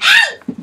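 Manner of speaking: high, loud "ow" yelp; pained yelp. Real, not acted. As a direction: yelp sharply in sudden pain. Hurt while holding cat.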